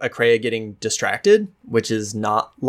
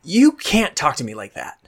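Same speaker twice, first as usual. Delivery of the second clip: a firm warning pitched up; narrow-eyed warning; 5 semitones higher than usual. Acted. Akreya glaring up at Marin, firm warning. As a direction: lay down the line, glaring. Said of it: "her eyes narrow and she goes".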